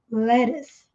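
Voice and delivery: "lettuce" said with a flapped T, "leres"; let us like lettuce. Shown as a demonstration. Teacher shows "let us" sounds like "lettuce". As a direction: say "lettuce" fast with a soft flapped T. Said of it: "soa muito parecido com a alface".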